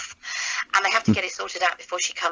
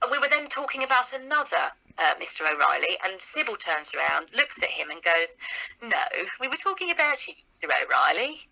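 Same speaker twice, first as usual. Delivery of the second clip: Sybil Fawlty. Acted. Actress showing her audition line as Sybil, haughty character voice. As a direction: pitch leaps up, then drops onto the name. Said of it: "I won't do the voice yet until I do the line".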